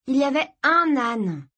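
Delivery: put-on high, excited girl's cry; exclaiming. A narrator's reading. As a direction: burst out with it as an indignant sister. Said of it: "s'écria Kirsty".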